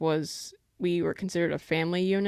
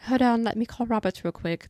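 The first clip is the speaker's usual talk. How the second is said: higher-pitched mock British accent; 6 semitones higher, 3 dB louder than usual. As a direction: put on a playful British accent. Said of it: "That's the best British accent".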